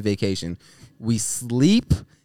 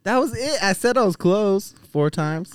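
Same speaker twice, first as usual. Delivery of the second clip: defensive; 10 semitones higher than usual. Real, not acted. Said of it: "you sound very defensive".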